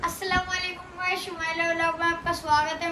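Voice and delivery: talking with nose pinched; pinching nose. Pretend. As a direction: squeeze your nose shut and speak. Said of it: "वो आप नाम पकड़ के बोलो".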